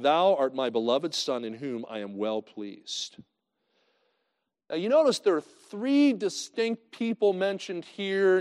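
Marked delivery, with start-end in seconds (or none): 0.0-3.2 slow, solemn reading, fading softer; slow solemn voice from heaven; scripture reading. Acted. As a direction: read the heavenly voice slowly and solemnly.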